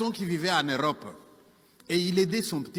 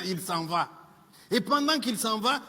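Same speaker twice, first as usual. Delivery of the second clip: normal talking voice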